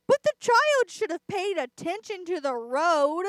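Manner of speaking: loud, high-pitched protest; real heated objection, pushed loud and high; exasperated outburst. Real, not acted. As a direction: raise your voice in exasperation at someone's carelessness. Ranting about child in road.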